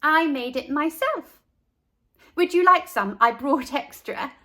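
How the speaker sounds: lightly voiced bright young girl; a proud kid offering her lunch; cheerful girl voice. A narrator's reading. Carla offers her sandwich.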